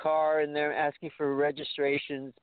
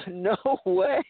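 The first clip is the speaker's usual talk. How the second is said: laughing; 3 semitones higher, 4 dB louder, slower than usual. Retold or imitated. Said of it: "I was laughing".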